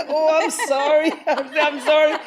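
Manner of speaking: laughing apology. Retold or imitated. Embarrassed apology to grandmother.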